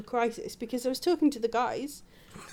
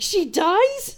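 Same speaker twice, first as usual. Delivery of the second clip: high, louder incredulous question; shocked disbelief; 8 semitones higher, 7 dB louder than usual. Retold or imitated. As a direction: blurt it like all-caps text. Retold shocked message, second take.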